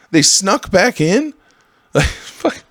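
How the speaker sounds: normal talking voice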